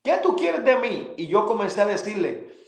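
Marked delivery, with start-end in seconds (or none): 0.0-1.1 high, pleading outcry, drawn out; retold desperate cry. On the floor of the room, begging God, desperate. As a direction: cry it up to heaven in despair. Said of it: "gritando, desesperando".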